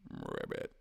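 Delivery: very low croaked "Rabbit"; frog croak. Retold or imitated. The frog clamming up, a deep croak.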